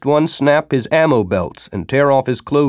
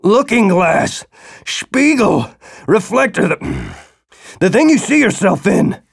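frantic shouting; 8 semitones higher than usual. Acted. Feverish man explains frantically.

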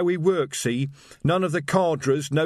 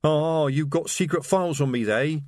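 eager, higher, a touch of manic glee; terrifying happiness; 5 semitones higher than usual. A narrator's reading. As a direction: delight in being persecuted. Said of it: "said Reg with terrifying happiness".

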